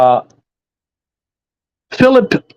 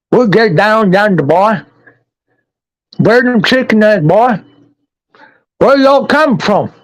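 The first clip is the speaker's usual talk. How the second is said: put-on higher, drawling hillbilly accent, menacing city folks; hammed-up backwoods moonshiner sizing up strangers; Kentucky hillbilly; 5 semitones higher than usual. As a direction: play the creepy mountain man for laughs. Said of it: "if we're gonna go hillbilly all the way".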